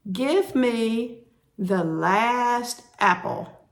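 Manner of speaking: normal talking voice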